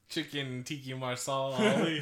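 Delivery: slow, loud "Chicken Tiki Marsal Ali" punned like the dish; joking mangled name. Pretend. Friend teasing the mispronounced actor's name, slow playful pun. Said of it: "I pronounce things the way I wanna".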